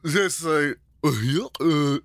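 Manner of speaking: high Southern drawl, laughing; high Southern cartoon drawl; Southern Goofy voice. Shown as a demonstration. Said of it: "I sound like I'm from the South".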